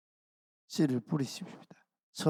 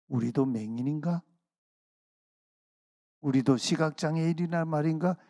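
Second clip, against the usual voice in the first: mildly mocking rhetorical question; sarcastic retort; 2 semitones higher than usual. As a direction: lightly scoff it back as a question. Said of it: "비꼬듯이 반문합니다".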